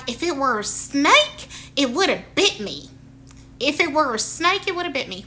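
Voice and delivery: imitating a Southern woman's saying; Southern woman accent. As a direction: put on a playful Southern accent.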